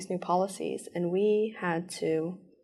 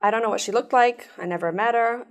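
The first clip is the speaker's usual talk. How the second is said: ordinary talk, nothing marked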